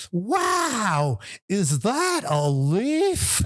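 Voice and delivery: high, drawn-out gushing amazement; exaggerated wide-eyed wonder, stretched out high; Hugh. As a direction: impersonate a host marvelling slowly at a trifle. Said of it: "He'd go like".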